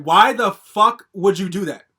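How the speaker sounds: a real grievance, spat out slowly; slow angry demand. Real, not acted. Ranting at the producer who strung artists along, slow and loud. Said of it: "I was so fucking angry".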